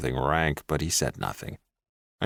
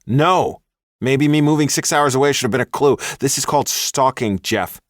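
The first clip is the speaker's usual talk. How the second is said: higher, loud angry rebuke; furious; 9 semitones higher, 6 dB louder than usual. A narrator's reading. Woman telling her ex off for stalking. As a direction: call him out hard.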